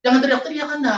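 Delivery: high, loud reprimand; retold scolding. Man retelling how he scolded someone yelling about God, stern.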